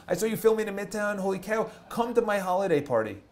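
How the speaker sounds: high, gushing party invitation; mock excited invite. Girl inviting the vlogger to her holiday party, gushing. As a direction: bubble over like an eager fan. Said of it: "She goes".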